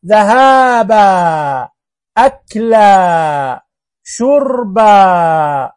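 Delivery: very slow, stretching the final alif of أكلا and شربا; slow careful demo. Shown as a demonstration. Teacher to the good boys and girls, very slow careful reading. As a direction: spell it out slowly for children. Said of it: "انتبهوا".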